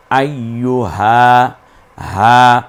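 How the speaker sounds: হা stretched one alif long; drawn out. Shown as a demonstration. Teacher shows the stretched হা to students. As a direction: hold the a of হা, slowly. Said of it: "এক আলিফ টেনে বলতাম".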